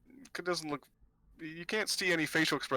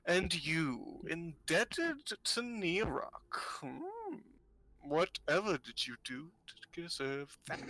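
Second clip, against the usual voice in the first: put-on slow, higher, lilting old seer; probing old fortune-teller; 5 semitones higher, much slower than usual. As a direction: needle them with drawn-out curiosity.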